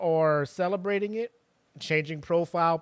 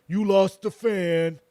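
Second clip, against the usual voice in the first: louder, drawn-out mock outrage; higher, louder mock complaint; mock-mad commenter; 4 semitones higher, 8 dB louder, slower than usual. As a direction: imitate an offended fan, slowly and pointedly. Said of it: "people getting mad".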